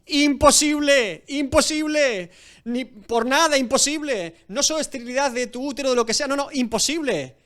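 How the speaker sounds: emphatic cry of impossible; body's flat refusal. Acted. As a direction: shout down the hope as an aging body would. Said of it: "su cuerpo dice".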